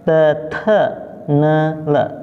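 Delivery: "的特呢了" very slowly, "特" with a puff of air; drawn-out teacher's model of "的特呢了"; repeat-after-me drill. Shown as a demonstration. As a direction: lead "的特呢了" one slow syllable at a time. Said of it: "Ikuti, 老师".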